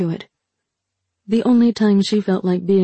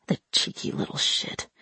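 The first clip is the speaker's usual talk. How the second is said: muttered whisper aside; 8 dB quieter than usual. Acted. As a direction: hiss it under your breath. Aside, hushed other voice.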